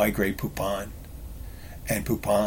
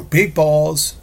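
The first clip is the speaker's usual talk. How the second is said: sudden high yelled blurt, much higher and louder; Tourette's outburst; 7 semitones higher, 8 dB louder than usual. Shown as a demonstration. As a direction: blurt it out like an involuntary tic, loud. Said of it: "Tourette's outrageous statement where you just go".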